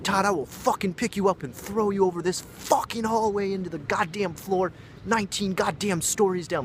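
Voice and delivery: very high-pitched, full-tilt threatening rant; going nuts. Retold or imitated. Raging roommate threatening Todd in the hallway, going nuts. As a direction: imitate an enraged man losing it completely. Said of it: "he was going nuts".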